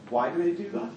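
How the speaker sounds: usual speaking voice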